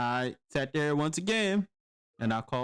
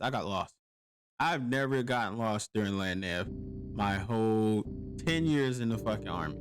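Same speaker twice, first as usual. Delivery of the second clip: ordinary delivery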